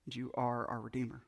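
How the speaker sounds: hushed closing prayer. Acted. Pastor closing a prayer to the congregation, hushed and reverent. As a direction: end the prayer softly and reverently.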